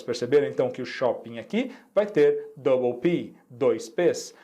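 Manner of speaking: normal talking voice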